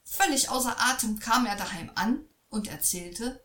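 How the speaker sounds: plain reading voice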